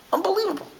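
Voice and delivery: high marvelling exclamation; amazed. Real, not acted. Teacher reacting to the miracle story with high amazement.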